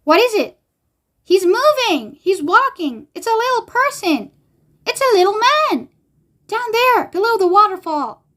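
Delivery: high, breathless-with-wonder exclamations; reader's controlled excited screaming; screams of excitement. A narrator's reading. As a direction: cry out each discovery on a high pitch. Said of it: "filled with screams of excitement".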